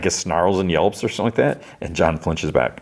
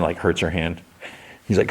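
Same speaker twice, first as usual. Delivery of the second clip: plain reading voice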